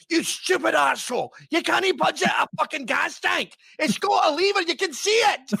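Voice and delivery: high, screechy angry rant; imitated furious mum yelling; mother berating husband. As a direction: scold your husband at the top of your range. Acts mother berating dad over gas.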